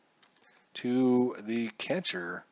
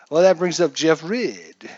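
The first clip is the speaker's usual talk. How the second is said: ordinary talk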